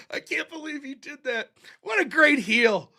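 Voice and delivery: ordinary talk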